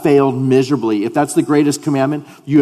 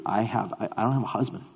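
hushed evasive; 3 semitones lower, 10 dB quieter, faster than usual. Acted. Woman's answer repeated.

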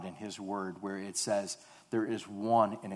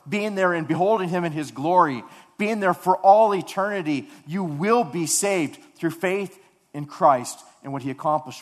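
louder, higher impassioned sermon voice; full-force preaching; 5 semitones higher, 9 dB louder than usual. Acted. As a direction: preach it with rising fervour, like a revival pulpit.

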